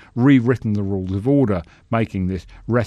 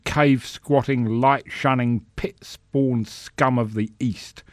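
pompous senator oratory; 4 semitones higher than usual. Acted. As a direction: thunder it from the podium. Senator's filibuster speech.

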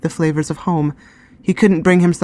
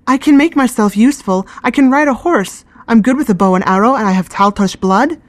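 higher and louder, urgent pleading; a girl begging to be taken along; 6 semitones higher, 4 dB louder than usual. Acted.

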